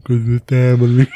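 loud, mock-solemn family line; hammed-up Vin Diesel family line; mock Toretto line. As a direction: belt it out as a joke. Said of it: "Do you know why?".

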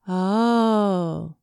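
long drawn-out "oh"; dawning realisation. A narrator's reading. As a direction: let the penny drop slowly. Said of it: "Then the penny dropped".

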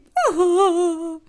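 high, loud "oh-ho-ho" laugh; delighted whoop. Acted. Grandma presenting the picnic treats, delighted laugh. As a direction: chuckle high in grandma's put-on voice. Grandma figure ends her speech.

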